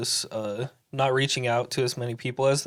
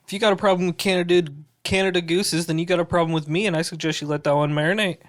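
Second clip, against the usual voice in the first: put-on Canadian accent; 4 semitones higher than usual. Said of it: "That was a good Canadian accent".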